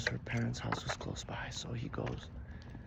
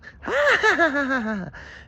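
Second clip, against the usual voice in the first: high, loud villainous cackle; witch's cackle; 14 dB louder than usual. A narrator's reading. Witch laughs over the man she punched, shrieking cackle. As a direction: cackle in wicked triumph. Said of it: "She starts laughing".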